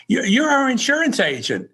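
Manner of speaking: high-pitched retold exclamation; excited recognition. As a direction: imitate an excited stranger spotting someone. Woman jumps up at mistaken recognition.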